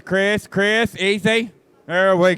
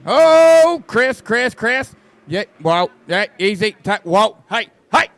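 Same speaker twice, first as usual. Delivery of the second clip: alarmed calling out; 7 dB louder than usual. Real, not acted. Driver nearly loses the car.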